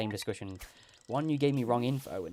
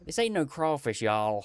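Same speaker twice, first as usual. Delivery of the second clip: Southern American accent; 2 semitones higher, slower than usual. Shown as a demonstration. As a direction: ham up a Southern drawl. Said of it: "do it in my American accent".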